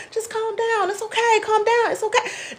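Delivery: high, soft sing-song soothing; mock soothing. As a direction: coo it like a patronising helper.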